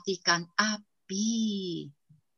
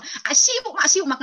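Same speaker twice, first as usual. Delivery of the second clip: high, loud hurried excuse; brushing off mom; 9 semitones higher, 5 dB louder than usual. Retold or imitated. Son brushing off his mother on the phone, high and loud. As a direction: wave her off with a high mock excuse.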